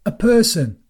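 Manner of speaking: sudden high excited cry; excited call. Acted. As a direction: call out, thrilled someone came. Brian spots a visitor, excited.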